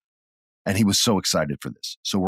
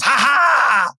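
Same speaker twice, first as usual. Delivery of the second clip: loud, high gotcha laugh; triumphant mock laugh; 5 dB louder than usual. Son jumping up to fool the crowd, a loud gotcha laugh. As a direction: crow it like a kid who pranked everyone. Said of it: "he goes".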